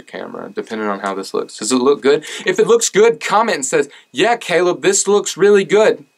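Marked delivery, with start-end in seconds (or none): 1.7-6.0 high, loud mock-eager voice; playfully overexcited, high; hammed-up enthusiasm. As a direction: pitch it high like an over-keen commenter. Hammed-up plea for viewer comments.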